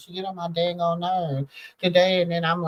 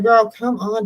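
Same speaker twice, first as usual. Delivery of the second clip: mock scolding; 6 semitones higher, 5 dB louder than usual. Scolding her dog, loud burst.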